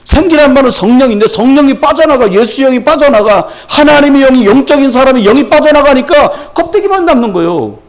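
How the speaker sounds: high, relentless sermon build; full-force preaching. Real, not acted. Preacher explaining the spirit leaving man, urgent high preaching. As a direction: press on urgently, phrase upon phrase.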